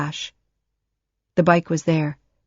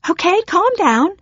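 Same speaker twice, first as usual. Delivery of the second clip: put-on teen placating; 13 semitones higher, 4 dB louder than usual. Teen placating angry mother.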